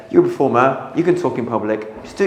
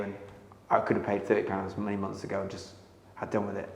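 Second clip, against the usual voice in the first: quiet plea; 5 semitones lower, 9 dB quieter than usual. Retold or imitated. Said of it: "I said".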